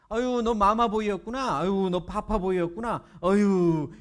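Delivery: exaggerated mock baby-coddling jeer; mocking tease. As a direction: tease a friend like he's a baby. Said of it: "이러면서 막 놀리는 거예요".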